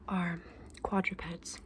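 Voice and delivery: normal talking voice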